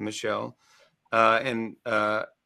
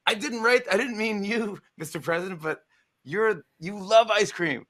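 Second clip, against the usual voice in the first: flustered backpedaling; 9 semitones higher than usual. Retold or imitated. Jimmy backpedaling to the president about ice cream, higher flustered voice. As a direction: scramble to explain yourself. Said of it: "And I'm like".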